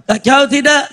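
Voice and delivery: normal talking voice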